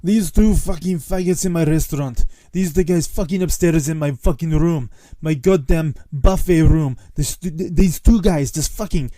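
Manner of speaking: bad Greek accent, yelling in rage; angry old Greek accent. Restaurant owner firing naked cooks, furious Greek shouting. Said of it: "worst Greek impression of an old Greek guy who's very angry".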